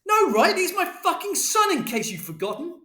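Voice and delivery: very high, incredulous yelling; explode, then ease down at the end; furious shout. A narrator's reading. Father outraged about his son, yelling high. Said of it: "And Father shouted".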